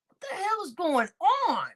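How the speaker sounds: ordinary talk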